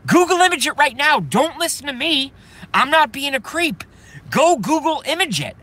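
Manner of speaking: high, loud, urgent insistence; practically shouting the order at viewers; pushing the audience to look it up now; excited high urging. Real, not acted. Ranting urging viewers to search.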